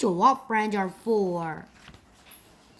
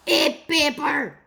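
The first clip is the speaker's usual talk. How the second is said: monster roar; 5 semitones higher, 4 dB louder than usual. A narrator's reading. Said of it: "roared the sea monster".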